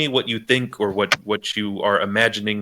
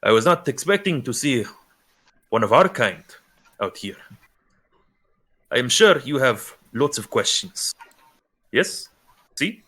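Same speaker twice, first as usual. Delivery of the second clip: lightly voiced Spanish accent, amused; a hint of a Spanish-accented stranger greeting kindly. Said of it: "a Spanish accent behind you".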